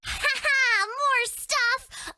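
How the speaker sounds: high, laughing glee over gifts; delighted laugh. Acted. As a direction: giggle with greedy delight.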